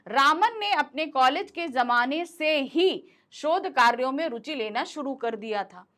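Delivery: teacher reading with जमाने से ही stressed. Shown as a demonstration. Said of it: "इस पर जोर देने के लिए हमने यहाँ ही शब्द का इस्तेमाल किया".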